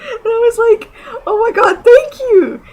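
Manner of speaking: laughing, very high-pitched fangirl squeal; near-tears giddiness. Real, not acted. Fan reliving the compliment on her shirt, giddy and near tears. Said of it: "I'm going to cry".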